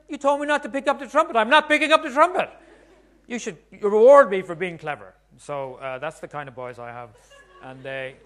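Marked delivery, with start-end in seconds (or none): 0.1-5.1 much higher and louder, cheeky with a laugh; a kid gloating at his own loophole; triumphant kid. Retold or imitated. Son caught blowing the trumpet.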